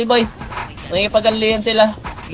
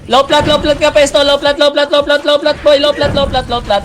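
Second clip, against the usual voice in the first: excited callout; 7 semitones higher than usual. Real, not acted. Rapid game callouts under pressure.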